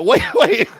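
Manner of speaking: high, loud, laughing disbelief; incredulous yelp through laughter; baffled interruption. Retold or imitated. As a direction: cut in loudly, cracking up. Baffled interruption, acted while laughing.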